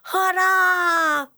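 high, very loud drawn-out cheer; Jubelschrei. A narrator's reading. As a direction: cheer as a tiny overjoyed worm. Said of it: "jubelt der kleine Regenwurm". (hoch und laut jubelnd)

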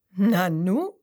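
a loud startled "Nanu"; surprised. A narrator's reading. Narrator hearing a strange noise, startled. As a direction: sound caught off guard.

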